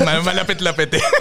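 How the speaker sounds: ordinary talk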